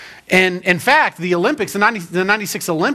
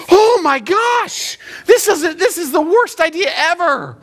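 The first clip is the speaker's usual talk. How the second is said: mock horror; 11 semitones higher than usual. Novice rafter hears about hitting rocks, hammed-up horror. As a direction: lament it with exaggerated dread.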